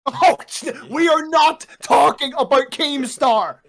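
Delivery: high, loud and slow; playful shout. Real, not acted. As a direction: yell it to shut the topic down. Refusing topic, yelling playfully.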